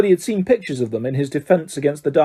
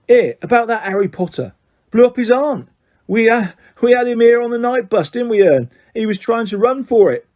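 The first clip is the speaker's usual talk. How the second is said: much higher, gleeful gossip; lightly voiced Cockney lad bragging; Stan's voice; 8 semitones higher, 4 dB louder than usual. Stan imagined gossiping.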